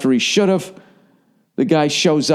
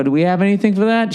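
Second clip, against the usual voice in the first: mock whiny self; 8 semitones higher, faster than usual. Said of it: "I go".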